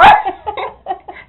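high, loud burst of laughter; helpless real laugh; cracking up. Real, not acted. Laughing at the punchline.

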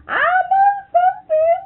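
high, loud, laughing sing-song; old woman bursting to tell her secret; gleeful secret. Retold or imitated. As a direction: tease with a secret, squealing with glee. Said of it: "she says".

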